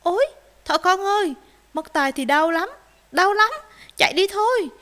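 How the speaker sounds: high, loud alarmed cry; frightened. A narrator's reading. Puppy flees fox's threat, frightened and high. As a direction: back out in a panic. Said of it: "Cún con sợ hãi".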